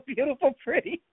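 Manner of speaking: amused, laughing delivery. Real, not acted. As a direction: giggle your way through the words. Laughing while coining a nickname.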